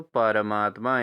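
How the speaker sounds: usual speaking voice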